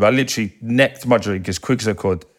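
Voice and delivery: ordinary talk, nothing marked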